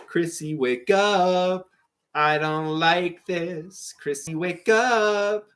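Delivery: slow, worried pleading. Acted. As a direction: coax her awake, slowly. Reciting Stranger Things scene.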